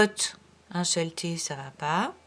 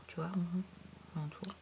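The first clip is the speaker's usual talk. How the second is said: whisper; 2 semitones lower, 17 dB quieter than usual. Real, not acted. Teacher whispers while circling a word for the pupil.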